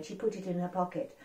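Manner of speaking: ordinary delivery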